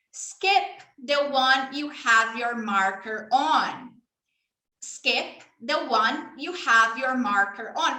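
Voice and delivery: You lost your turn said very slowly; slow teacher demo. Shown as a demonstration. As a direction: spell it out slowly for a child. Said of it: "demo for a child".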